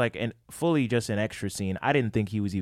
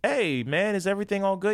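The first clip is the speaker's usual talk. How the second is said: friendly greeting; 10 semitones higher than usual. Retold or imitated. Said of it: "And I was just like".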